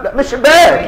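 high, exclaimed correction; mock exclamation. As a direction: exclaim it in mock alarm, pitch leaping up. Mock correction of panicked exit answer.

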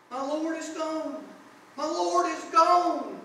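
rising to a loud, high, desperate wail; anguished cry. Acted. Mary at the empty tomb, telling the angels, desperate wail. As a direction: cry out as if your Lord were stolen.

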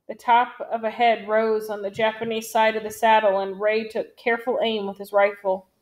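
ordinary delivery

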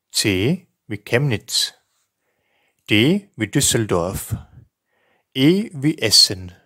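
each letter and name spoken slowly and distinctly with pauses; careful spelling dictation. Shown as a demonstration. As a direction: dictate it clearly, like spelling over the phone. Teacher reads spelling alphabet.

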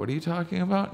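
high-pitched incredulous question, retold; baffled disbelief. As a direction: shoot up high in mock bewilderment. Retold baffled reply to friend.

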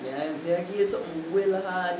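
normal talking voice

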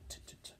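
whispered t, t, t; soft unvoiced t, t, t; letter sound. Shown as a demonstration. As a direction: breathe the t sound. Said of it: "add a capital letter".

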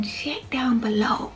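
whispered, drawn-out reminder; playful whisper. Real, not acted. Vlogger reminding viewers where the links are, playful slow whisper. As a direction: whisper it slowly, stretching the words.

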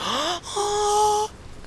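sharp high gasp; mock gasp of hope. Player imitating a sudden hopeful gasp. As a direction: gasp as if saved at last. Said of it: "where I'm like".

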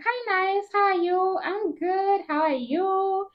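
the boss greeting, sing-song and high; sweet boss voice. Retold or imitated.

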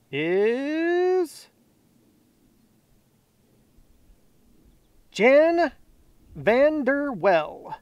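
drawn-out, high announcer tease; suspenseful winner reveal. Real, not acted. Host reveals fourth giveaway winner, drawn-out announcement. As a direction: stretch each word high for suspense.

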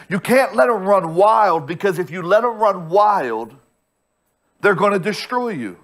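ordinary talk, nothing marked

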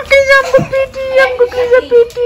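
very high-pitched fake wail; hammed-up hurt whine; mock crying. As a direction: squeal a pretend tearful complaint. Playfully complaining that child hit them.

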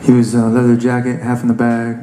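ordinary talk, nothing marked

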